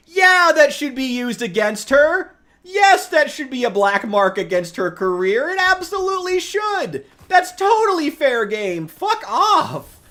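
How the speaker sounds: high, loud, slow indignant ranting; angry. Real, not acted. Streamer fuming at his viewers about the dean, loud and indignant. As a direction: pound each point home, furious.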